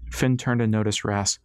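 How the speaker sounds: plain reading voice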